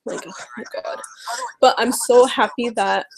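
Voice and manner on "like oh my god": breathy, unvoiced oh my God; exasperated whisper. Real, not acted. Vlogger muttering in disbelief at the campaign, whispered.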